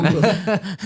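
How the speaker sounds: short real burst of laughter; laughing. Real, not acted. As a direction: laugh out loud at her own joke. Risada após piada do arrebatamento. (risada curta e alta)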